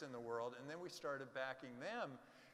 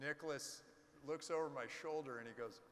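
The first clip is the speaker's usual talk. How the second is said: usual speaking voice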